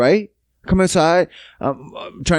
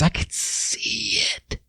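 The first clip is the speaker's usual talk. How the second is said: softer, unvoiced, close to tears; close-to-tears whisper about his scared daughter; choked-up whisper; 7 semitones lower, 8 dB quieter, slower than usual. Real, not acted. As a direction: whisper it as your voice catches. Describing daughter's fear.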